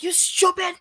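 high put-on insulting voice; mocking insult. Man insulting the young driver, high mock voice. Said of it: "And Ma say".

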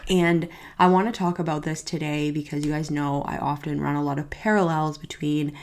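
normal talking voice

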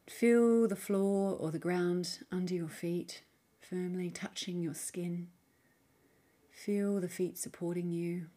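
soft, unhurried meditation voice with long pauses; guided meditation. Acted. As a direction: gently guide the listener inward, leaving space between phrases. Leads a guided mindfulness practice.

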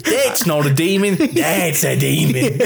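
hammed-up Australian, slowing on the second sentence; broad joke Australian, starting high and ending half laughing; mock Australian accent. Podcaster parodies the Australian girl for laughs, exaggerated Australian accent. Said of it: "with an Australian accent".

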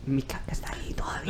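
hushed, secretive admission; unvoiced, secretive whisper; whispered confession. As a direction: whisper it so the family won't hear. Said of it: "whispered confession to friend".

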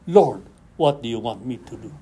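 low, soft, submissive plea; humble prayer. Retold or imitated. Preacher voices a submissive prayer.

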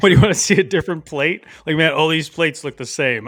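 hammed-up fed-up grumble; mock exasperation. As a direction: complain loudly as if fed up. Mocking the monotony of dishwashing.

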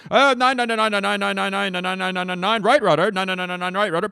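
high, loud babble of helm numbers; frantic movie impression. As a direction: rattle numbers off in a hammed-up panic. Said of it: "here's my impression of".

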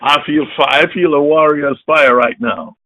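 normal talking voice

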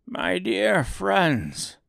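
high, very slow appeal; appealing little man. A narrator's reading. Oz appealing to his disappointed visitors, slow plea. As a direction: plead slowly, pitched high.

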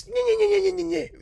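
high mocking whine nyeh nyeh; whining nyeh. Mocking whiny people to viewers, high nyeh nyeh. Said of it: "this whiny shit".

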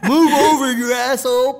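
loud, high-pitched hammed-up driver yell; mock road rage. Teasing cameraman about his driving, mock angry shout. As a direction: yell over the horn in a squeaky rage.

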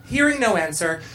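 ordinary delivery, nothing marked

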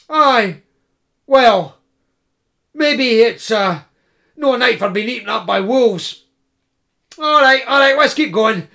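high, stammering, fussing student voice; nervous hungry student. Acted. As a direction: fret and stammer about supper. Character voice Koma giving in.

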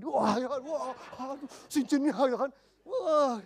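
high, loud wide-eyed wonder at the ring; greedy awe, voice jumping up; dazzled gasp. Acted. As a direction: gawk at the glittering ring. Dazzled by Hotman Paris's ring.

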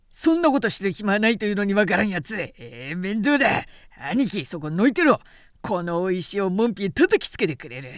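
fast, loud impatient bluster; gruff hothead; exasperated warrior. Acted. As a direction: threaten to smash the gate. Zhang Fei loses patience.

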